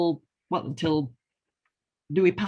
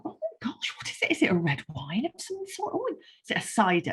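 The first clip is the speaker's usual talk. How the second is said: mock amazement; 5 semitones higher than usual. A friend tasting sour beer, high amazed surprise. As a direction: play the stunned taster, pitched way up. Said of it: "And they'll go".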